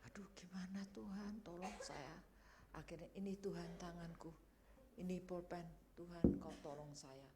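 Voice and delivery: soft, near-whispered plea to God; retold murmured cry for help; helpless hushed prayer. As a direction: pray it under your breath, at a loss. Retold tearful, helpless prayer in exam.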